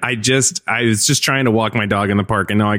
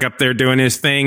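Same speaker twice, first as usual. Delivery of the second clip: usual speaking voice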